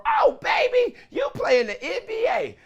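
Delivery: high, excited squeal of a fan; starstruck fan. Retold or imitated. A grocery store fan meeting an NBA player, squealing high. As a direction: gush like a starstruck shopper. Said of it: "You go to the grocery store".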